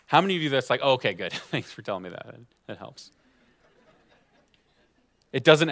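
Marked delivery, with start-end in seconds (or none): 0.8-3.1 mock gratitude. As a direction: play the unconvinced worrier, deadpan and lower. Sarcastic reply to useless advice.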